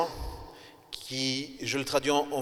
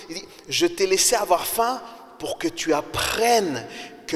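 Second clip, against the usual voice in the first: raised, emphatic sermon voice; preaching; 4 semitones higher, 3 dB louder than usual. Acted. Pastor preaching to his congregation, raised and emphatic.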